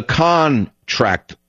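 CON drawn out slowly in "contract"; stress on CON. Real, not acted. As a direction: lean hard on the first syllable of "contract", CON-tract. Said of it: "the emphasis on the word con".

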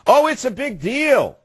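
high, slow sneering mock of big deal; sarcastic mockery. Host mocking the supervisor's excuse, sneering high and slow.

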